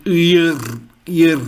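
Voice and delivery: "ear" said as the German name of R; German letter R. Shown as a demonstration. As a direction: roll the r at the end of "ear". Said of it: "very specific pronunciation".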